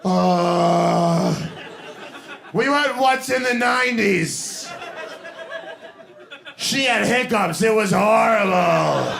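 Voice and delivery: high, strained half-laughing recollection; mock traumatized. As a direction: play someone still haunted by it. Traumatized circus-goer recalling.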